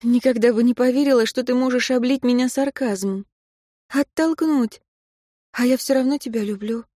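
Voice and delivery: a hint of a hurt young woman; Katya, a young woman. A narrator's reading.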